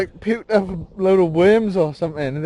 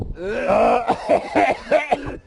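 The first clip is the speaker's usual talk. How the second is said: gagging cough; 4 semitones higher than usual. Real, not acted. Gagging at gross sight.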